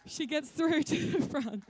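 slow, laughing through the words; can't hold back a laugh mid-story; breaking up with laughter while telling it. Real, not acted.

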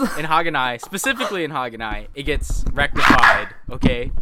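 loudly stressing rectified; firm and loud, pressing the point; insistent. Real, not acted. Insisting over the rant.

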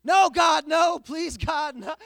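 screaming high and loud, comic despair; Michael Scott scream. Retold or imitated. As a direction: howl it like the Office meltdown. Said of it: "Y'all remember when Toby comes back?".

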